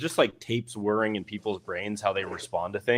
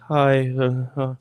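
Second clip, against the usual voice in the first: mumbling; 3 semitones higher, slower than usual. Retold or imitated. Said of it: "are you mumbling".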